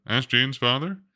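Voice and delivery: plain reading voice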